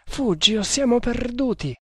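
high, desperate warning; terrified. A narrator's reading. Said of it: "fuori di sé per il terrore". (atterrita, con voce acuta)

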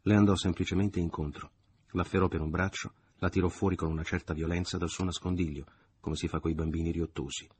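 ordinary delivery

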